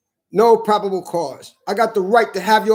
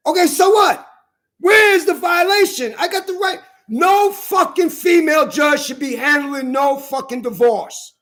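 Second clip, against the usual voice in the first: raised, high-pitched indignant shouting; angry protest; 4 semitones higher than usual. Real, not acted. Man defending his right to say it, angry protest.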